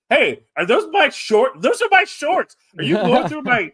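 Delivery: high, loud outraged wrestler impression; Kevin Owens. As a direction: accuse him in a hammed-up, high-pitched shout. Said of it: "the real Kevin Owens comes out".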